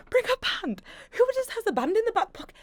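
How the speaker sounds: very high, squealed disbelief; mock incredulous. Retelling reaction to college's demand for a band, squeakily incredulous. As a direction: repeat it back in a high, indignant squeal.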